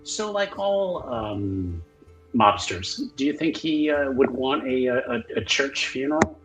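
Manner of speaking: normal talking voice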